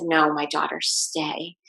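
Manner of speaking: quiet fatherly reassurance; gentle voice. Retold or imitated. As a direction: hush it and gently hold them in place. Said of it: "in the most gentle voice".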